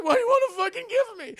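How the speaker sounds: loud, high-pitched exasperated shriek; hammed-up hysterical question; frantic. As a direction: demand it in a panic. Frazzled friend snapping back.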